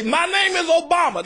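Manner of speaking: high-pitched orator's proclamation, imitated; mock Obama speech. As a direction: mimic a politician playing to applause. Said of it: "I mean, he'd say".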